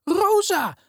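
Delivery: high joyful cry of a name; cheering. A narrator's reading. Elena cheers to her friend Rosa, high and joyful. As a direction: shout it out with pure joy. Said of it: "juicht ze".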